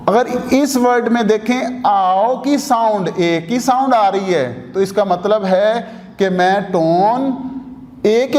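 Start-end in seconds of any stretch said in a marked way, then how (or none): none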